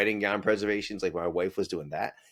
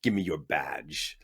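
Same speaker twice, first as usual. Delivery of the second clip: guard voice; 5 semitones lower, 5 dB quieter, much slower than usual. Retold or imitated.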